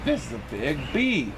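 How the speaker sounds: ordinary delivery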